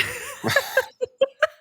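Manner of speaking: high tearful laugh-sob; real crying breaking through, high and wordless; tearful outburst. Real, not acted. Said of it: "I'm crying right now".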